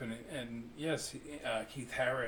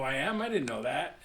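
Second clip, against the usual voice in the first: louder mock-surprised reply; retold surprise; 2 semitones higher, 7 dB louder than usual. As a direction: act out being caught off guard. Acting out own surprised reply.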